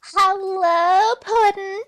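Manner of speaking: high, loud Harley Quinn squeal. Acted. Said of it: "that's actually the right pitch for that voice".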